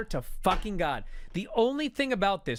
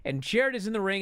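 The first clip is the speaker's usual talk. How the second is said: ordinary talk, nothing marked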